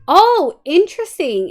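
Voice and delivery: high, loud surprised "oh". Real, not acted. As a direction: react with sudden surprise. Sudden high reaction.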